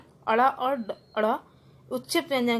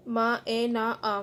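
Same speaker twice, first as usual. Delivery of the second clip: each sound held apart; teacher splitting a word into sounds; sounded out by letter; much slower than usual. Shown as a demonstration. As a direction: say मा, ए, ना, आ one by one. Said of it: "अलग-अलग करके भी दिखाया".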